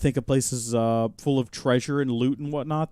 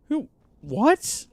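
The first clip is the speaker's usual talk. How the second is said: normal talking voice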